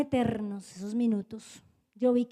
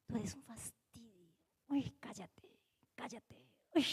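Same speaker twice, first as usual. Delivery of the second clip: hissed, whispered contempt, much softer; contemptuous whisper; 3 semitones lower, 16 dB quieter than usual. Retold or imitated. Daughter whispering contempt at her mother, hushed. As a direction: whisper it with disdain like a fed-up twelve-year-old. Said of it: "pero así".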